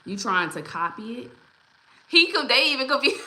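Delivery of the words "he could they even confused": high, amused laughter through the words; laughing. Real, not acted. Host laughing at the confused replies, amused.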